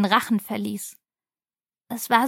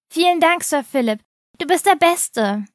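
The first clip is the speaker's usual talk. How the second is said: put-on higher, excited thank-you from a child; a farm boy thanking his hero, higher and eager; grateful boy's cry; 5 semitones higher than usual. A narrator's reading. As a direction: pitch up like a thrilled farm boy. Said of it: "rief er".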